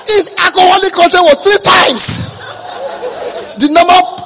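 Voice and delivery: high, loud laughing shout. Real, not acted. Preacher laughing loudly at story.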